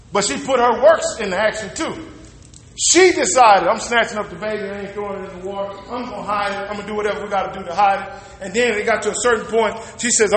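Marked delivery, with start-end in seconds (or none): 3.7-8.1 quiet, quick determined mutter; mama quietly making up her mind; mother's resolve. Retold or imitated. Preacher voicing Moses' mother deciding, quiet and quick. Mother's decision voiced in retelling.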